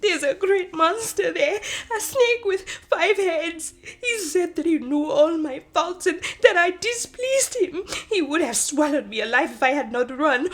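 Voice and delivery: high, loud, tearful and frantic; terrified sobbing. Acted. Sister warns of the five-headed snake, sobbing in terror. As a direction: sob out the horror, pleading.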